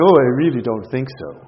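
mock the unbeliever's dismissal; sniffy skeptic, lightly done; skeptical science guy. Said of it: "some science guy going".

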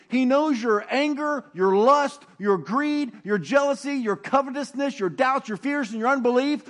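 ordinary delivery

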